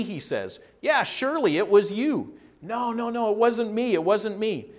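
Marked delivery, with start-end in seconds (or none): 0.8-2.4 higher, louder acted accuser; insistent accusation. 2.6-4.7 high-pitched, hurried-sounding protest; protesting denial. Acted. Acted repeated denial by Peter.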